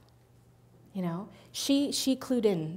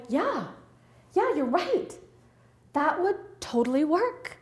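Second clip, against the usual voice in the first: hopeful realization; 6 semitones higher than usual. Retold or imitated. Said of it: "this ray of sunshine".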